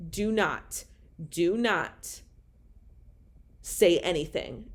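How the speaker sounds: firm warning. Retold or imitated. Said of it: "I made it very clear to her".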